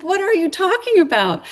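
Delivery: can't believe what she's hearing; incredulous. Retold or imitated. Mother questioning her college son, incredulous.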